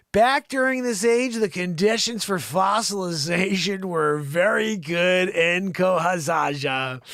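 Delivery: loud, slow announcer-style reading; news read. Acted. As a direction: project the story slowly, starting high and settling. Resumes reading science story.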